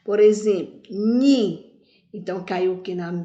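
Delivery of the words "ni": "ni" alone, loud and held; forceful syllable. Shown as a demonstration. Teacher showing the syllable said with more force, "ni" punched loud. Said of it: "com mais força".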